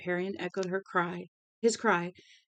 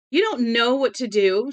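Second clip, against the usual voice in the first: loud, high furious scream; 5 semitones higher, 12 dB louder than usual. A narrator's reading. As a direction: scream the blame at them. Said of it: "she screamed at them".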